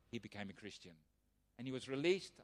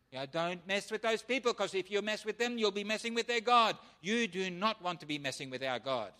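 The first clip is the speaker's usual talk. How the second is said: louder, higher preaching build; 5 semitones higher, 7 dB louder than usual. Acted. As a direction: drive the warning home. Preaching warning to the crowd.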